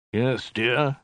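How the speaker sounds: mildly absent husbandly reply; absently. A narrator's reading. Khan busy with his maps, absent. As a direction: answer without really listening. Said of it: "said Khan absently".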